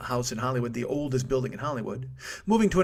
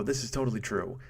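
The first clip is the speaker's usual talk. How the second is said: ordinary talk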